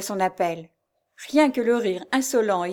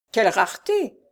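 lightly mocking exclamation; sneering; 3 dB louder than usual. A narrator's reading. Stranger scoffs at the faithful wife, sneering. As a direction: jeer with a touch of irony. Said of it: "fit l'inconnu en ricanant".

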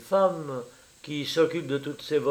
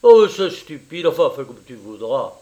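loud burst sinking into grudging surrender; grumbling; 10 dB louder than usual. A narrator's reading. Friend gives up arguing about the woman, grumbling. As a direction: give in with bad grace. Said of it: "Il grommela".

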